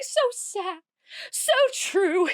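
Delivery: dramatic Ursula. Acted. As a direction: ham it up like a villain playing the victim. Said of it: "giving Ursula some dramatic acting choices".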